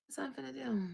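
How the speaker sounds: sigh, then whisper it quickly to yourself; whispered aside. Real, not acted.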